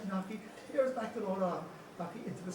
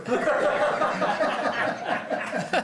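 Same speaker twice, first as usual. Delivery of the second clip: high, loud burst of laughing; real laughter; 12 semitones higher, 12 dB louder than usual. Real, not acted. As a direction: roar with laughter at the punchline. Laughter after punchline.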